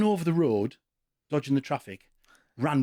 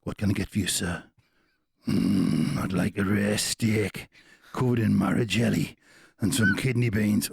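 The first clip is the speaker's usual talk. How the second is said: deep, slow comic posh diner ordering; lower, drawn-out impression of Bob Mortimer as a fussy diner; 7 semitones lower, slower than usual. A man ordering a strange steak, in deep slow comic voice. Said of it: "do me a Bob Mortimer".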